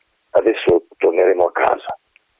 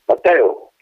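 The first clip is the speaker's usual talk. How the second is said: shout; 7 semitones higher than usual. Acted. Colonel calling the departing wind back, shouting. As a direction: call him back before he leaves. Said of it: "gridò allora il procolo".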